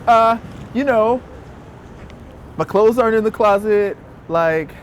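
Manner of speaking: high, halting, nervous explaining; nervously hinting at a big secret; awkward self. Retold or imitated. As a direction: stumble through it awkwardly.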